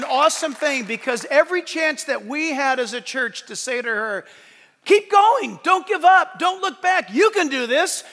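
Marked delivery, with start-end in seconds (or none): 4.8-8.0 high, loud rallying call; cheering encouragement. Retold or imitated. Church urging a struggling member on, loud cheering. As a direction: cheer her on like a coach.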